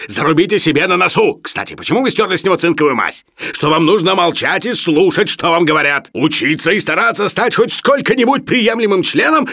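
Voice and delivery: high, loud scolding; thundering lecture. A narrator's reading. Professor orders Sharikov to keep silent and learn, thundering. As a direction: drive the reprimand home angrily. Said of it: "гремел Филипп Филиппович".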